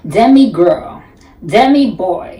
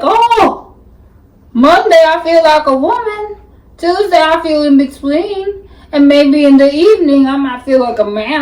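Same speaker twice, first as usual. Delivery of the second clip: high, mocking camp voice, pitched up; mock gender-fluid voice; 6 semitones higher than usual. Mocking a gender-fluid person to viewers, in a high camp voice. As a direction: lightly ham up a flighty persona.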